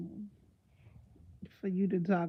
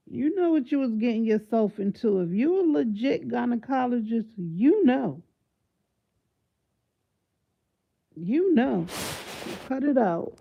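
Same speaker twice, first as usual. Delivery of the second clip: loud scolding; 3 semitones higher, 6 dB louder than usual. Real, not acted. Scolding the doctor.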